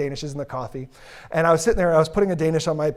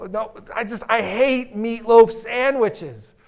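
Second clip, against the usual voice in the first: slow, drawn-out whining; whiny complaint; 4 semitones higher, slower than usual. Retold or imitated. Worker refusing the ham to his lunch buddy, with a slow drawn-out whine. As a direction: drag the complaint out.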